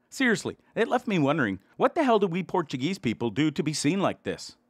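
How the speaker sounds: ordinary delivery, nothing marked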